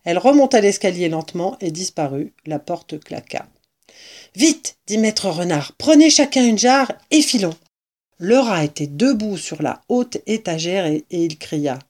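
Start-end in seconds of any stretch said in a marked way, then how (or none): 4.4-4.8 quick, high burst; urgent order. A narrator's reading. Fox hurries companions with jars, urgent. 5.8-7.6 high, loud urging; rally them for a getaway; urgent order. A narrator's reading. Fox tells companions to grab jars, urgent.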